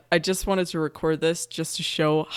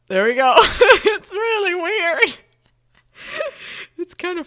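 laughing hard; 12 semitones higher, 8 dB louder than usual. Real, not acted. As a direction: crack up while talking. Laughing at own hiccups.

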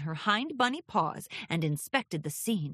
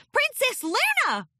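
high, loud startled cry; shocked filly; 6 dB louder than usual. Acted. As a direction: gasp out a sudden sight.

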